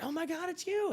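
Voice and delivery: high-pitched fan squeal; mock excited fan squeal. Fan spotting him squeals in mock delight, high and excited.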